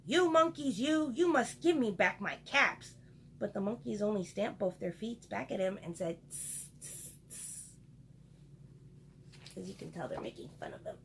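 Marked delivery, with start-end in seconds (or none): 0.0-2.9 loud, high-pitched storybook shout; angry shout. A narrator's reading. Peddler stamping both feet at monkeys, shouting angrily. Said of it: "stamped both his feet and shouted". 6.3-7.8 three short soft "tss" sounds; mocking monkey hiss. Monkeys mimicking the peddler from the tree, hissing.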